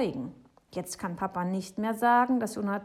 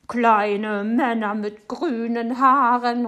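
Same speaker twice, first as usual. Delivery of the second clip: very loud, drawn-out scoff; calling out; 4 semitones higher, 13 dB louder, slower than usual. A narrator's reading. Neighbour scorning the boy's story, crying out slowly. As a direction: exclaim it slowly in outraged disbelief. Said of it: "ruft Frau Segebrecht".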